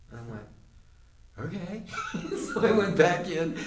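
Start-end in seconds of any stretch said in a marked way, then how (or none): 1.4-3.6 high-pitched okay breaking into a laugh; amused laughing. Real, not acted. Storyteller recalling the New York guy's advice, amused laughing. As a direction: laugh your way into the next sentence.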